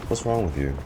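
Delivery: ordinary talk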